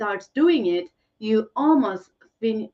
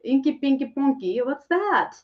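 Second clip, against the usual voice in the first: delighted; 5 semitones higher, 4 dB louder than usual. Real, not acted. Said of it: "That's such a cute inky ponky".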